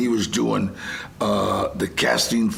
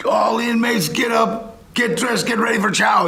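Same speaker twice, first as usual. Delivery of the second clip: prison wake-up call; 11 semitones higher, 3 dB louder than usual. Retold or imitated. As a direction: call it out to a whole cellblock. Re-enacts prison wake-up announcement.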